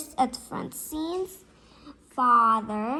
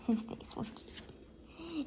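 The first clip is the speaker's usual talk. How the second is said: whisper; 4 semitones higher, 13 dB quieter, slower than usual. A narrator's reading.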